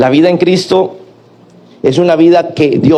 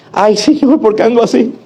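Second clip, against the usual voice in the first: mock lament; 5 semitones higher than usual. Mock sinner laments to God.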